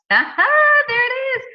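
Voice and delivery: aha moment. Real, not acted. Answering her own quiz question.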